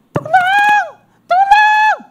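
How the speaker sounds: high loud desperate shout; crying for help. Acted. Trapped mouse shouting for help, high and loud. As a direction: shout for help across the forest.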